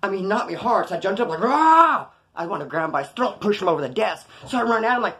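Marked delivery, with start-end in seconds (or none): 1.4-2.1 loud, high imitated battle yell; enraged roar. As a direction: roar as if charging in a rage. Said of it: "just that mad rage".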